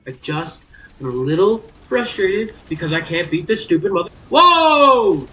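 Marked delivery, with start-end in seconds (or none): 3.6-5.3 loud cut-off curse into a yelp; real gamer fury, loud and high; angry outburst. Real, not acted. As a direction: rage snapping into surprise. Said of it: "this has genuinely pissed me off".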